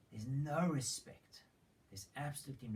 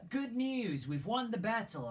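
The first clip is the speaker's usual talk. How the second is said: much higher, loud triumphant cry; excited announcement; 10 semitones higher, 7 dB louder than usual. Retold or imitated. Ahimaaz announces the victory to David, triumphant. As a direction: burst in with the victory news.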